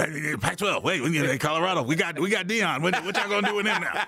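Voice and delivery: high, mocking taunt; imitating Cliff teasing. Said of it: "'Cause he'd be like".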